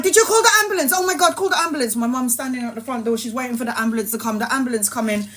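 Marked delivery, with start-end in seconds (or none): 0.0-2.0 much higher, urgent; imitated frantic mother; panicked mum. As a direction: demand it in panic.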